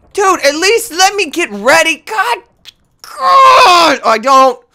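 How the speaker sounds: high whiny cry out; distressed cry. Real, not acted. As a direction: wail in frustration. Crying out under attack.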